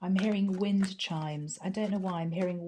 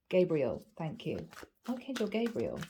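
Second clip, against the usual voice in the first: usual speaking voice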